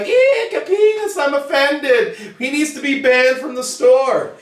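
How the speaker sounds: high squealing mock-offended shriek that settles into a prim complaint; prissy shriek. Mocking an offended store regular with a high shocked shriek. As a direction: squeal like a scandalized prude, then huff. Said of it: "But he'd be like".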